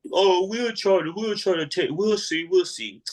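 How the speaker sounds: slow, high noncommittal drawl; mimicking lazy detectives; dismissive brush-off. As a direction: brush him off lazily.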